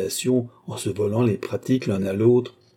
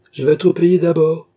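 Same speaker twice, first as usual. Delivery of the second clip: defiant demand; 7 semitones higher, 9 dB louder than usual. A narrator's reading. Coachman demanding payment before moving.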